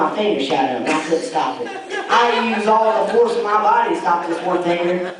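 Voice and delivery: normal talking voice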